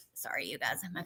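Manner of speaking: hushed apology to listeners; quick whispered sorry; whispered aside. Real, not acted. Apology aside after slipping.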